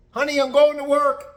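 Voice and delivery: high put-on husband voice; high, sing-song domestic parody; cheery husband. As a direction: call out a mock-breezy goodbye. Plays husband leaving home.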